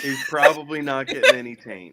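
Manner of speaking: laughing repeat. Real, not acted. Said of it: "repeats friend's line laughing".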